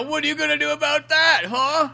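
taunting villain. Retold or imitated. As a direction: goad him like a bully. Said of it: "Look, Tony".

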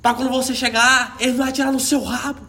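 high loud yelling. Retold or imitated. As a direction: bark it back at him. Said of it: "o cara gritando lá dentro do tanque".

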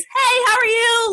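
high, slow and friendly hello; overdone Southern hospitality greeting; cheery Southern greeting. Retold or imitated. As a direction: sing out the hello like a Southern neighbor. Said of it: "we call it Southern hospitality".

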